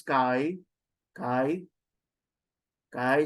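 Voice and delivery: "kai" dropping in pitch; grave accent falling. Shown as a demonstration. Teacher demonstrating a grave accent, kai going down. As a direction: let the voice sink on "kai". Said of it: "it goes down".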